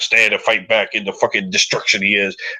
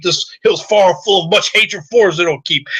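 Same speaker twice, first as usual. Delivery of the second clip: normal talking voice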